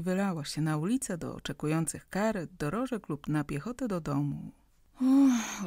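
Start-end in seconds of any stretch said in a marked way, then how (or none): 5.0-5.7 puffing out. Acted. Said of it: "odsapnął Andrzej".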